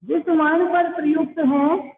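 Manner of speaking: ordinary delivery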